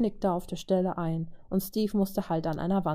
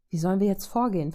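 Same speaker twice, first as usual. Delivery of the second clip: fast, pressing demand; urgent question; faster than usual. Acted. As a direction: rattle it off, no time to lose. Steve demands a plan in battle.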